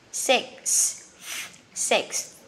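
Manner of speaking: sixth with a clear ks cluster before the th; careful English pronunciation. Shown as a demonstration. Teacher drilling sixth for learners, crisp repetitions. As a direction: repeat sixth, pushing the ks-th ending out. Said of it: "sẽ là sixth".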